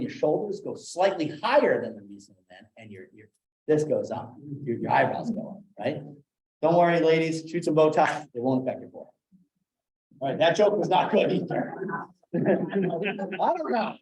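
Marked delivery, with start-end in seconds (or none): none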